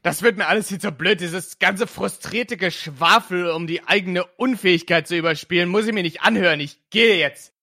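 fed up, storming out of the room; shouting at the family before leaving; furious outburst. Acted. Julian storming off angrily.

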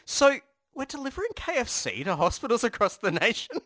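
unsure questioning. Retold or imitated. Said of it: "they're going".

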